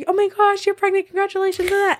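high, bright mock cheer; imitating an overjoyed friend; gushing congrats. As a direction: squeal it like a thrilled friend. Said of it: "you have the people who it's like".

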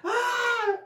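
short high squeal; mock crowd cheer. Recounting her win to friends, high mock cheer.

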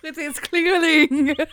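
laughing. Real, not acted. Joke, slow laughing.

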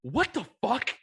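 wife reacting to a stranger sniffing her husband, shocked; shocked outburst. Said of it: "my wife is like".

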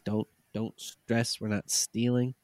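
ordinary delivery

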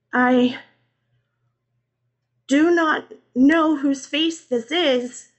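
high, halting, loud tearful plea; acted weeping, word by word; sobbing. A narrator's reading. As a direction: force it out slowly between sobs. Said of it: "Christina sobbed".

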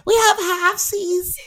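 put-on high, slow, babyish halfsies; cutesy high voice. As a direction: coo it like a cute baby. Cutesy imitation of ad word.

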